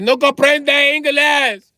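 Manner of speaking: slow, loud mock Spanish accent, high. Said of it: "He be like".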